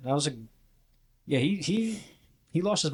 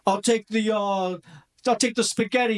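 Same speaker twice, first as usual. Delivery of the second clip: Lucky DeBono ordering; 9 semitones higher than usual. Retold or imitated. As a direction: order spaghetti like an over-the-top hustler. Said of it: "Which is not how he talks".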